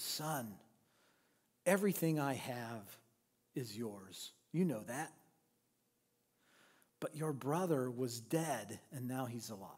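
a gentle father pleading close to his son; tender fatherly plea. Acted. Father holds son by elbows.

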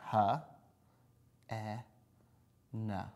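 segmenting each sound slowly. Shown as a demonstration. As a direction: sound out each letter separately. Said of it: "Spell hen".

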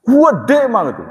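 high, loud village-dialect "Gede mah gitu"; Cibubur dialect demo. Shown as a demonstration. Imitating the Cibubur accent to show why listeners laugh. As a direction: show how the Cibubur folk talk. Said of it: "Bahasanya tuh di, begini nih".

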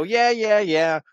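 high, loud brush-off; dismissive retort. Retold or imitated. Dismissing him in acted quarrel.

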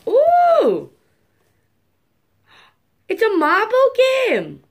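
high, loud delighted ooh; thrilled unboxing squeal; excited squeal. Real, not acted. Excited at new toy.

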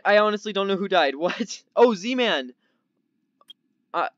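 usual speaking voice

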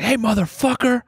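high yelled wake-up call; mock scream. Comic shouting to wake a dozing fan. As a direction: yell at the sleeping old man, high. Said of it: "I was screaming at him".

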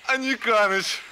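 loud joyful call. Real, not acted. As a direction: shout his name across the room. Calling out a name joyfully.

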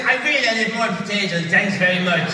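self-parodying, hammed-up Irish accent; mock Irish accent. Father at the family dinner table, mock Irish accent. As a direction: send up your own Irish accent. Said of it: "I do it in my own house".

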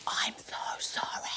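slow, exaggerated mouthed whisper; overemphasised whisper. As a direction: whisper it slowly, overdoing every word. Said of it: "which is the overemphasised whisper".

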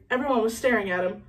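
plain reading voice

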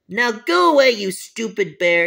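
loud, high bratty yell at the bear; bratty insult. Pretend. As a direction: hurl it at her like a spoiled bully. Bully insults Bonnie Bear.

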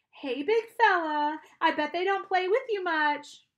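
loud, high coaxing voice; high-pitched doggy coaxing; coaxing dog talk. A narrator's reading.

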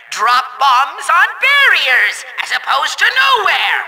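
exasperated but controlled instruction; pointed, condescending villain delivery; sarcastic patience. Acted. Repeating instruction to bungling player.

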